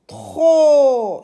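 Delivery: TRO held long, high and very loud; demonstrating tro as one long syllable; one-breath syllable. Shown as a demonstration. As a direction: belt tro out in one sustained sound. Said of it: "c'est une syllabe".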